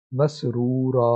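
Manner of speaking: مسرورا stretched slowly, ending on a long a; slow reading with waqf. Shown as a demonstration. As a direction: read مسرورا slowly, stopping on the alif. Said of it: "تو اس کو ہم پڑھیں گے". (مسرورا کو آہستہ کھینچ کر الف پر رکیں)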